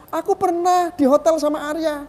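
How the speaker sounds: high-pitched mimicked complaint; mock tearful accusation. Speaker corrects his imitation of the accusing woman, mock tearful. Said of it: "Eh, bukan begitu ngomongnya".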